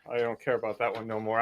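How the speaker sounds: normal talking voice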